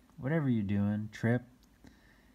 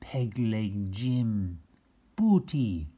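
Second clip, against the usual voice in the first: ordinary delivery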